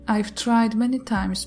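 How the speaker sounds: usual speaking voice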